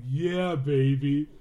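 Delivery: put-on loud goofy "yeah baby"; goofy Stu voice. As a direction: ham it up like a dopey killer. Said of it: "Stu's is like".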